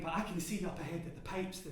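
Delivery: usual speaking voice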